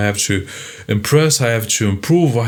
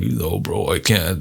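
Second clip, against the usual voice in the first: macho bro; 2 semitones lower, 5 dB quieter than usual. Retold or imitated. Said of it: "And they're like".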